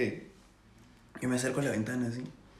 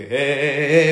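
loud chanted eh eh; yelling; 3 semitones higher, 11 dB louder, slower than usual. Retold or imitated. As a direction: shout at the neighbours to break it up. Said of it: "empecé a gritar". (gritando eh eh a los vecinos)

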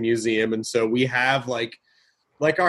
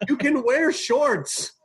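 high, incredulous mock lecture; exasperated husband; 10 semitones higher than usual. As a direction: pitch it up in disbelief, laughing at the end. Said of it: "And I'm like".

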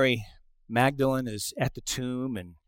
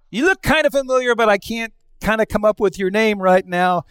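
high, louder, hurried bluster; playful, raised-pitch excuse; mock greeting; 8 semitones higher, 6 dB louder than usual. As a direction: cover a forgotten name, pitched up. Mock fumbling for a name.

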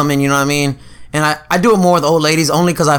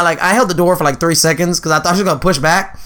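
usual speaking voice

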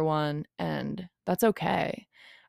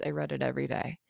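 softer and lower, almost to herself; soft, lower repeat to herself; quiet repeat; 4 semitones lower, 7 dB quieter, faster than usual. Real, not acted. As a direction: let the repeat sink into a soft, lower murmur. Repeats the line, then softer.

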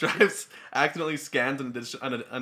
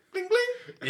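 scanner beep imitation; 3 dB quieter than usual.

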